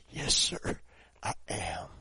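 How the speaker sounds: faint dying whisper, barely voiced; weak whisper. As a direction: breathe it out like a dying man. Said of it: "But he said it like this".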